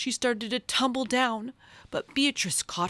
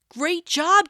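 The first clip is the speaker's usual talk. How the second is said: high, loud proud cheer; 9 semitones higher, 7 dB louder than usual. Acted. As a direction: mom and friends praising her landing. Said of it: "cheered Lottie's mom".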